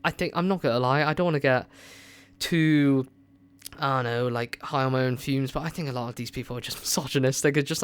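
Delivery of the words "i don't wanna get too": normal talking voice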